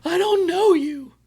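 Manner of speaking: high, slow, whimpering squeak; scared high voice. Retold or imitated. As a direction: squeak it out like a cornered kid. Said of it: "in a corner going".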